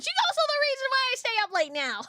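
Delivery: high, fast, playful blame; squeaky excited. Real, not acted. Laughing blame of sister.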